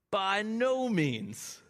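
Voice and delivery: slow, high, emphatic "By no means"; enthusiastic. Retold or imitated. Preacher voicing Paul's answer, slow and enthusiastic. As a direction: draw it out with eager conviction. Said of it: "Enthusiastic, plainly".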